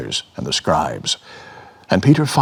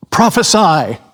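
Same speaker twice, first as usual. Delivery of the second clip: higher, louder jeering shout; mocking shout; 9 semitones higher, 5 dB louder than usual. Mockers beating Jesus, jeering shout. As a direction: taunt him with a jeer.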